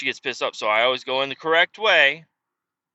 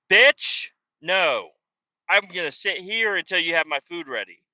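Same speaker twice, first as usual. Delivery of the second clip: defiant retort; 3 semitones higher than usual. Retold or imitated. Customer refusing to pull to spot three, defiant. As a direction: plant your feet and refuse to move. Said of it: "It's like".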